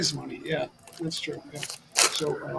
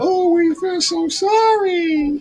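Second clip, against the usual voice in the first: mock sympathy; 10 semitones higher, 6 dB louder than usual. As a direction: gush with hollow sorrow.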